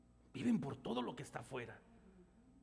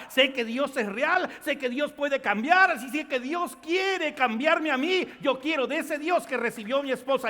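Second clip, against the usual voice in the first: loud joyful declaration; loud exclaiming husband; 8 semitones higher, 11 dB louder than usual. Acted. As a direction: voice raised high and loud, declaring. Husband's loud testimony quote.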